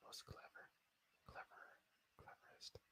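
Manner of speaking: unvoiced whisper, reading the chat to self. Real, not acted. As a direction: mouth the words off the screen.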